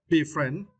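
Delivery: Be friend with friend stressed, be light; Be friend, weight on FRIEND. Shown as a demonstration. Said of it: "stress the second syllable".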